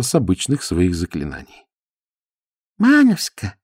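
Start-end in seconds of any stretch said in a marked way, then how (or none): 2.8-3.6 high, fond broken-Russian voice; lovestruck Chinese suitor. A narrator's reading. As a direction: call her name lovingly in an accent. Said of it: "говорил он, влюблённо глядя".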